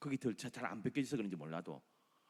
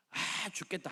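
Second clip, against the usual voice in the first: mock complaint; 7 semitones higher than usual. Voicing a grumbling believer.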